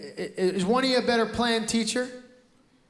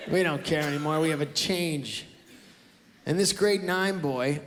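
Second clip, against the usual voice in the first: normal talking voice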